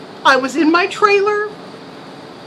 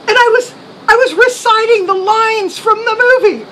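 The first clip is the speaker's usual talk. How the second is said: usual speaking voice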